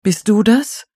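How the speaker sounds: high, anxious rising question; frightened. A narrator's reading. Frightened caller asking who is behind the door, afraid. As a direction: ask it nervously, pitched well up. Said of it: "mit derselben vor Schreck zitternden Stimme".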